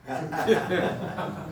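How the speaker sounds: surprised. Real, not acted. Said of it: "I walked away and I said".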